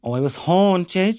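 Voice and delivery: put-on slow, lilting Cork accent; put-on Cork lilt, drawn out. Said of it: "most haunted places in Cork".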